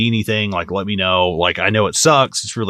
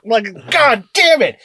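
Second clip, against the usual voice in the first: 1 exasperated; 11 semitones higher, slower than usual. Retold or imitated. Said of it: "be like".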